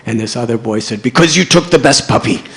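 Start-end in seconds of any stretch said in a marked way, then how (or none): 1.0-2.4 put-on loud complaining boy; a sore, accusing boy; indignant shouted grievance; angry boy. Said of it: "one of the boys was angry".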